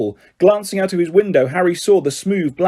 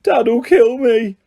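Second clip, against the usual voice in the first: despairing wail; 7 semitones higher, 3 dB louder, much slower than usual. A narrator's reading. Ron despairs after the car.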